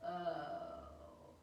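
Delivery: soft, low groan of dread; quiet, sinking, miserable moan; sickened, weary oh. Shown as a demonstration. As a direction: let it sag out, drained and queasy. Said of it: "everybody got the stomach flu".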